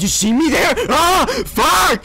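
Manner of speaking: loud question rising into a scream; panicked yell. Real, not acted. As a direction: cry out as you realise you're spotted. Spotted by the monster.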